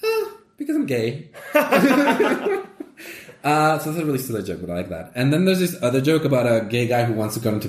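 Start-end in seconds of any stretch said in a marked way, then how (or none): 0.0-1.3 high, giggly proud punchline. Retold or imitated. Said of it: "the other two elephants go like". 1.4-2.8 laugh. Real, not acted. Punchline laugh.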